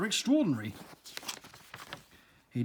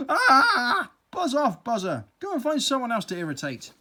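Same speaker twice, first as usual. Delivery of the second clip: loud, high cry of annoyance; irritated yell; 8 semitones higher, 7 dB louder than usual. Acted. Slug yelling at the buzzing bee, loud and high.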